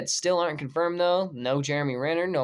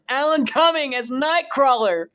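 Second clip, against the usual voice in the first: overwhelmed reading; 8 semitones higher, 6 dB louder than usual. Real, not acted. Emotional cast reveal.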